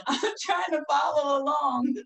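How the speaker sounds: high, loud and laughing, playing lost; laughingly mock your own confusion, voice lifting loud; comic cry for a translation; baffled, laughing plea. Acting out her confusion at the patois.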